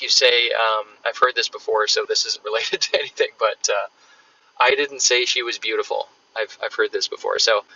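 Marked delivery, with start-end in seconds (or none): none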